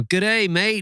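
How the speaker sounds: put-on high, wrong accent that sounds Australian; botched accent that comes out Aussie; bad Canadian accent. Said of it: "I can't do a Canadian accent".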